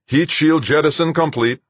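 high, loud status report; calling back. A narrator's reading. Rodriguez replying on the heat shield jettison, calling back. Crew reply to ringing call-out.

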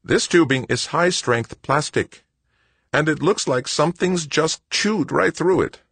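high-pitched exasperated mechanic; exasperated Wojo. A narrator's reading. Wojo insists on what happened.

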